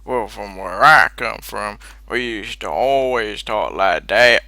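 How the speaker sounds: loud, slow Texan twang; Texan accent. Acted. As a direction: stretch it out in a hammed-up Texas accent. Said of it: "a bit more Texan".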